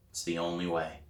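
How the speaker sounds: plain reading voice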